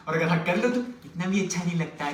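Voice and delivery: ordinary delivery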